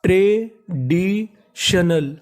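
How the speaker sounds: Traditional drawn out slowly, syllable by syllable. Shown as a demonstration. Teacher sounding out traditional slowly for students. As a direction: stretch traditional out for the class. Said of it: "तो पूरी meaning".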